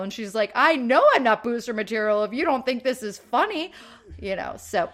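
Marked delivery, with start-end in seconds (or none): 0.5-3.7 loud, higher-pitched defiant comeback; mock retort. [name] answers the booster girl's rejection, loud defiant imitation. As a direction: throw it back at full volume.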